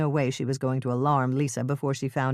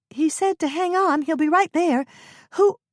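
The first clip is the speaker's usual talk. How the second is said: Lisa calling down; 14 semitones higher, 6 dB louder than usual. Acted.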